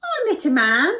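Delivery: high, loud character voice; cupbearer voice. Acted. Cupbearer tells Pharaoh about Joseph, high loud voice. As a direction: pipe up as the cupbearer. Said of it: "the cupbearer said".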